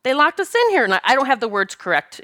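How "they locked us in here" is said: high-pitched imitation of a panicking child; distressed little girl. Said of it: "one of the little girls".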